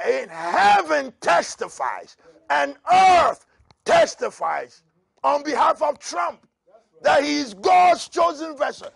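loud high prophetic shout; shouted prophecy. Acted. Preacher voicing the Lord to his listeners, shouting high. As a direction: declare it as the voice of God.

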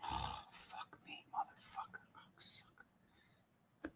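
slow, barely audible whispered swearing; voiceless, drawn-out defeated whisper; whispered cursing. Real, not acted. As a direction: curse under your breath, spent. Spent after losing his temper.

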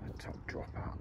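breathy murmur, barely voiced; whispered repeat. Real, not acted. Angler repeating the fly to himself, whispered. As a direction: mutter it again to yourself, unvoiced.

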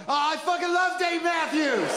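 high, slow, shouted rapture; a coffee customer bursting with joy over the band; mock-ecstatic fan shouting his love, high and drawn out; gushing fan. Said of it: "And then the other guy goes".

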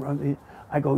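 ordinary talk

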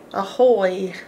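ordinary delivery

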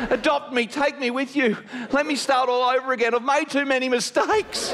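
high-pitched needy plea; hammed-up desperate pleading; pleading child. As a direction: beg like a little kid to be adopted. Acted plea of a six-year-old.

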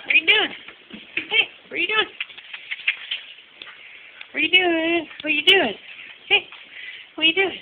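high sing-song voice to the cat; pet talk. Pretend. Boy teasing the cat, high pet-talk voice.

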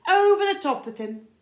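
very high, loud shout. Acted. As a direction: yell it to start the push. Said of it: "shouted the goat".

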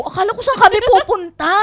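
high, loud puzzled exclamation; confused surprise. Retold or imitated. Caregiver confused by patient's words, high loud surprise.

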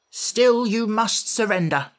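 put-on a girl general standing her ground; mock-fierce, deliberate demand; fierce insistence. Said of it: "exclaimed the general fiercely".

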